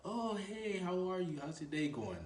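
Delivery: high, light imitation of a woman's hello; girl being approached. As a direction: play the girl greeting him back, voice lifted. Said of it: "She says".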